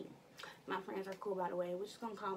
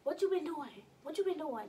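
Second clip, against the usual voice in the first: mock mom's suspicious questions, pitched up; 6 semitones higher than usual. Mom interrogating daughter at the door, higher mock suspicion. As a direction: play the mom pressing her high kid. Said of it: "be like".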